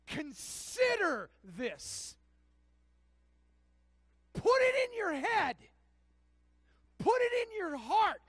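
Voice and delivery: loud, high, driving sermon voice; thundering pulpit proclamation; full-force preaching. Acted. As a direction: preach it out with rising fire.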